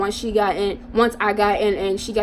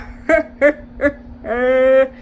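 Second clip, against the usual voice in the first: imitated creepy laugh; 2 semitones higher, 3 dB louder than usual. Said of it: "He's like".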